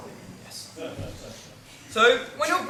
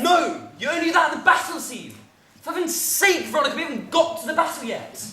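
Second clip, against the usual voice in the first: high, raised-voice telling-off; exasperated scolding; 7 semitones higher than usual. Acted. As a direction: cut them off in exasperation. Director scolds actors shouting too early.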